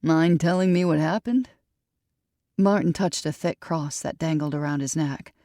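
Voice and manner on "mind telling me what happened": plain reading voice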